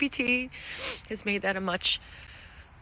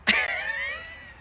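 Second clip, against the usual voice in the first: high, drawn-out laugh that fades; rueful laugh; 3 dB louder than usual. Real, not acted. Rueful reaction to losing pay.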